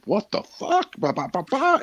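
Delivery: very high shrieking mock outrage; a mother losing it at the school; mom exploding. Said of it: "and my mom's like".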